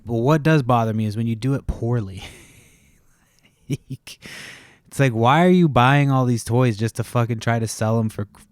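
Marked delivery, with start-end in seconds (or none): 3.7-4.5 a short voiceless chuckle; quick amused breath of a laugh; breathy laugh. Real, not acted. Amused at own remark.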